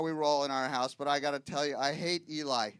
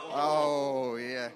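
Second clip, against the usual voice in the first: ordinary delivery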